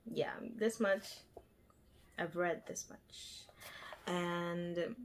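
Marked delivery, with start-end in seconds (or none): none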